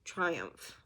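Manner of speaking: soft, halting, genuinely moved; holding back emotion. Real, not acted. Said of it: "trying not to get super emotional".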